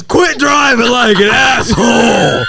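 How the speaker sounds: loud mock shout of a furious father; angry dad yell. Son imitating his dad scolding the neighbor. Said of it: "He says".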